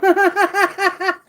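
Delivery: a high, loud burst of laughter. Real, not acted. Reader laughing hard at the card's insult. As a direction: crack up at the card.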